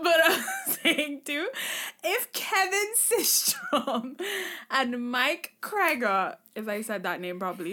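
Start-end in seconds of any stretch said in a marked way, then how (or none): 0.0-6.4 laughing high through the words; giggling as she names the founders. Real, not acted. Host laughing while naming the Instagram creators.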